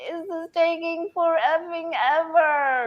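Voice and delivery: high whining complaint; whiny voice. Pretend. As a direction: whine it like a fed-up kid. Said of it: "our most whiny voice".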